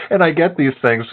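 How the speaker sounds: ordinary talk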